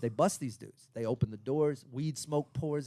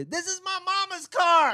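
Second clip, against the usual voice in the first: high-pitched mock scream, louder; 5 dB louder than usual. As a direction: imitate a panicked yell out the window. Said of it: "screaming out the window".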